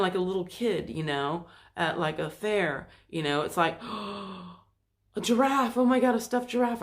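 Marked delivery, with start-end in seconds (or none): 3.9-4.5 excited little gasp; mock kid gasp. A child at the fair spotting a giraffe, gasping. 5.2-6.8 put-on higher, louder, fast kid voice; excited kid. A little kid at the fair begging for a giraffe, excited.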